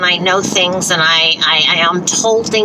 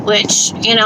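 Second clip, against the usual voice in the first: ordinary talk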